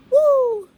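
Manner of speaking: loud, high "woo" after laughing; high whoop. Real, not acted. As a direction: let out a piercing whoop.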